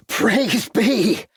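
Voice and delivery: high joyful relief; relieved exclamation. A narrator's reading. Shorter hearing the baby cry, high relieved exclamation. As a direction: burst out in relief. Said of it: "exclaimed a relieved Shorter".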